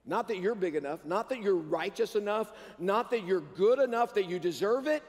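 ordinary talk, nothing marked

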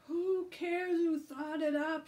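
high, drawn-out, loud wail of protest; shouting. A narrator's reading. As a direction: shout it in exasperation. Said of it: "shouted Tom".

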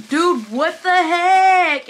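high, loud and drawn out; a slow, shocked cry of disbelief; stunned disbelief. Real, not acted. Unboxing gifts from a friend, drawn-out shocked exclamation.

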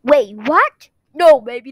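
loud alarmed protest; panicked. Acted. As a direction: blurt it out in panic. Caught out, panicking.

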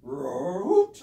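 roar turning to hoot. Pretend. Puppet owl trying to roar like a lion, failing. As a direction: start a roar, end in a squeaky hoot. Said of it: "keeps coming out as a hoot".

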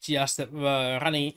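ordinary talk, nothing marked

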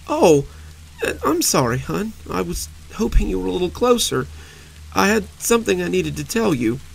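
high, halting, near tears; words falling apart in grief; voice breaking. Acted. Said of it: "Her words broke apart as she spoke".

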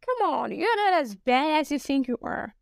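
usual speaking voice

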